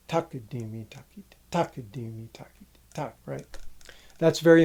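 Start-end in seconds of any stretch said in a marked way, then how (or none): 0.1-3.2 TAKADIMI hit on its first syllable, TAKITI lighter; even rhythmic syllables, first of each TAKADIMI accented; accented downbeats. Shown as a demonstration. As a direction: punch the TA opening each TAKADIMI and the final TAK. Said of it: "every downbeat I'm accenting".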